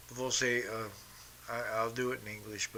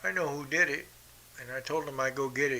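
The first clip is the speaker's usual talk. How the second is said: ordinary delivery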